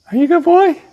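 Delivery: high sing-song praise for the dog; high dog talk. Real, not acted. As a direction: coo at the dog. Talking to his dog.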